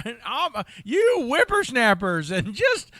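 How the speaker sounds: put-on high, loud, slow old Western codger; old-timey prospector. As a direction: shout like a grumpy old prospector. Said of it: "a old-timey prospector in his old age".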